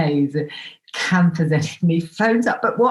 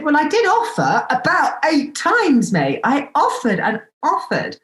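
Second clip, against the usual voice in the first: indignant protest; 7 semitones higher than usual. Retold or imitated. Indignant reply to demand.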